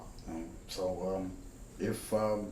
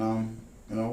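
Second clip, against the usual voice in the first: usual speaking voice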